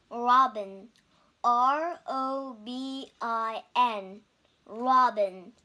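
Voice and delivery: robin said, then R O B I N letter by letter, slowly; spelled out slowly. Shown as a demonstration. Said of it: "我们学三个单词".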